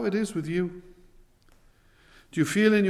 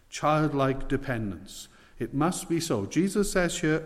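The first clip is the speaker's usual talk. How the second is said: ordinary talk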